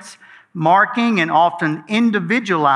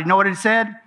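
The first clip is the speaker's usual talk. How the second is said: ordinary delivery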